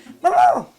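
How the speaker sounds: bright burst of real laughter; sudden delighted laugh; high laugh. Real, not acted. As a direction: laugh out loud at the memory. Delighted by barking dog memory.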